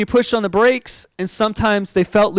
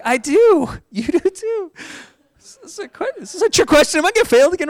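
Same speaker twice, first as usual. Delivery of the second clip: high, squeaky suspicion; comically anxious, pitch way up; nervous surprise; 12 semitones higher than usual. Retold or imitated. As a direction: squeak it out with a laugh, mock-panicked. Acts out nervous reply to examiner.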